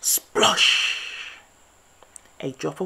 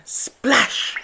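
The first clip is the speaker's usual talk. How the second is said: whispered splash; 9 semitones higher, 10 dB louder than usual. A narrator's reading. Sound effect word in story.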